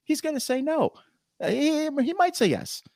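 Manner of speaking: very high-pitched, excited inner debate; giddy, high-voiced back-and-forth with yourself; hyped-up self-talk. Acts out arguing with himself.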